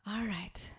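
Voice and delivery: soft, barely voiced whisper; hushed whisper. Real, not acted. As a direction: whisper it as a quiet cue to begin. Settling in before the meditation starts.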